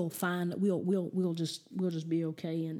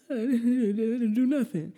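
put-on higher, whimpering child protesting innocence; crying little kid; 4 semitones higher, 4 dB louder than usual. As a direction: imitate a tearful little boy. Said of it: "He's crying, crying".

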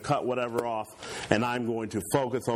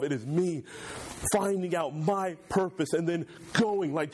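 high, fervent sermon climax; preaching at full force; 11 semitones higher than usual. Acted. Preacher telling church of Jesus' purpose, high fervent preaching. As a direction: drive the message home with fervor.

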